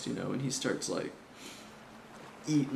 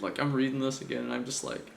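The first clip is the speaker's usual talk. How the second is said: normal talking voice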